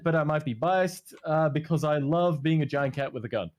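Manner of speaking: normal talking voice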